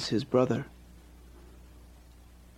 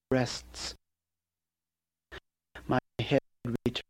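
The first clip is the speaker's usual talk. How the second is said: slow hushed poem fragments; slower than usual. Acted. Sparse fragmented sound poem.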